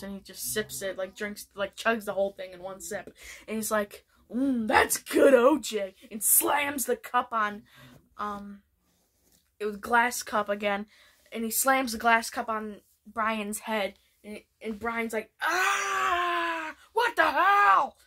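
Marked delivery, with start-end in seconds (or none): 4.3-5.9 1 smug sip. Retold or imitated. Stewie savouring juice menacingly. 15.4-18.0 high, loud yelp of pain; pained yell. Retold or imitated. Brian hit on the head by Stewie, high loud yelp.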